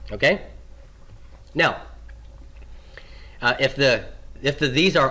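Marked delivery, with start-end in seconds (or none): none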